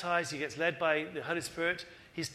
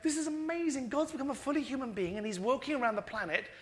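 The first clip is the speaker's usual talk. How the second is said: much higher, thrilled wonder; amazed angels; 7 semitones higher than usual. Retold or imitated. Angels in heaven marvelling at God on earth, high and excited. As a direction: gasp it out like a stunned angel, high. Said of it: "they must be going like".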